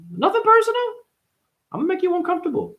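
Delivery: mock high voice. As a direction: squeak it out like a taunting cartoon. High mocking voice.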